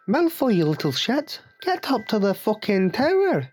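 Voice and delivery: high-pitched scolding Scottish professor; McGonagall. Acted. Professor ordering a pupil to the tower, high Scottish scolding. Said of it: "you've got Professor McGonagall".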